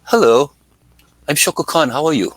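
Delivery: a hint of a posh British greeting; British accent. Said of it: "this British accent".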